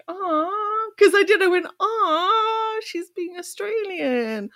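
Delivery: high, slow mock Australian aw; a playful Aussie drawl on aw; hammed-up Australian accent; imitating Australian. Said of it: "No, she's not being Australian".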